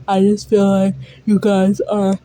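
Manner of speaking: talking through a big yawn; real yawn stretching the words. Real, not acted. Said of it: "I'm yawning so much".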